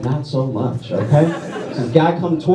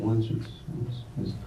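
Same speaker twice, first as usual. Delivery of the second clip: soft whispered count; whispering the numbers; 4 semitones lower, 15 dB quieter, faster than usual. Acted. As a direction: count it off under your breath. Counting under breath.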